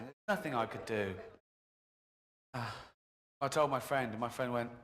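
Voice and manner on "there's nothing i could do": usual speaking voice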